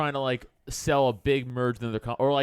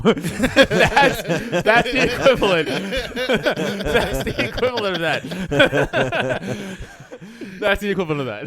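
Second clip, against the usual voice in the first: louder, cracking up mid-sentence; laughing; 4 semitones higher, 6 dB louder, much slower than usual. Real, not acted. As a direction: laugh through the words. Cracking up at his own story.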